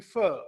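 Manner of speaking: refer with fer punched loud; stress on second syllable. Shown as a demonstration. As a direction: hit fer in refer hard, ri light. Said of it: "When you stress the second syllable". (refer में fer पर ज़ोर)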